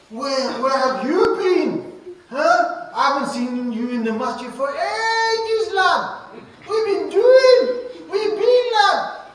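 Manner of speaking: smother him with an over-eager hello; overboard welcome. Shown as a demonstration. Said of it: "let me tell you what overboard is".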